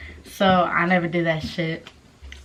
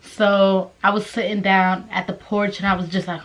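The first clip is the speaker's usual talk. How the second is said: normal talking voice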